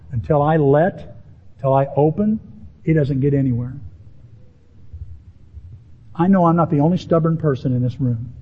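ordinary talk, nothing marked

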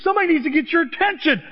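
high, loud berating shout; urgent full-voiced rebuke. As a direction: shout as God rousing a sleeper. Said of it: "he shouts because he".

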